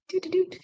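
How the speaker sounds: high, soft little doots; light high-pitched sing-song doots; playful doot-doot noise. Real, not acted. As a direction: toot out tiny cute filler notes. Playful noise about cute bookmark.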